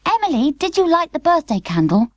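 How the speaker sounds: put-on a lightly high, worried girl; worried little girl. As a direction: call her sister in alarm. Charlotte accusing sister.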